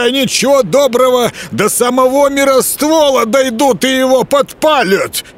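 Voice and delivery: high, urgent wood spirit; agitated forest spirit. A narrator's reading. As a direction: brush him off in a hurry. Leshy voiced by narrator.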